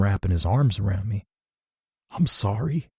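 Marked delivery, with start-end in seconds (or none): 2.1-2.9 higher, cracking apology; voice breaking. A narrator's reading. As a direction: let the voice break on the words. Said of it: "I muttered, my voice breaking".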